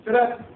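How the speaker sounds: camera click noise. Retold or imitated. Mimicking camera shutter click.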